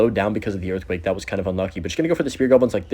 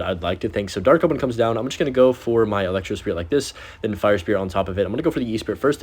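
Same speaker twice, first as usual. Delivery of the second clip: normal talking voice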